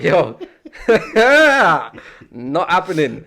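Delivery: loud laugh mid-line. Retold or imitated. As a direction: burst out laughing. Retold refusal, laughing loudly.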